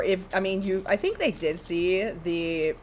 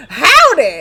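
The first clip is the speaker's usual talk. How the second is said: loud cowboy howdy; 8 semitones higher, 14 dB louder than usual. Retold or imitated. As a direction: belt out a cowboy greeting. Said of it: "I just go".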